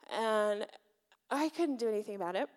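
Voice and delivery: usual speaking voice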